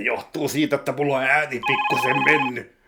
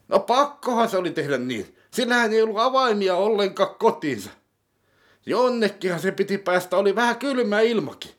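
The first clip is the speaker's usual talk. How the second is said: pitched-up dim sidekick, defensive; high character voice; 8 semitones higher than usual. Acted. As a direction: play the naive accomplice, voice pushed higher. (kohotettu hahmoääni)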